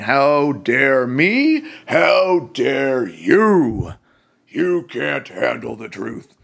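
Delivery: hammed-up, very drawn-out indignation; guns-blazing outrage. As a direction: play mock outrage, stretching each word. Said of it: "guns blazing".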